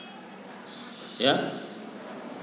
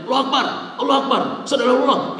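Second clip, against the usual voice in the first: rushed, high, barked mock call to prayer; tantrum-like azan; 10 semitones higher, 5 dB louder, faster than usual. Shown as a demonstration. Teacher showing students an azan without intonation, rushed and tantrum-like. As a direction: fire off the azan in hurried high bursts. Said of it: "Ini orang lagi azan tuh lagi ngadat".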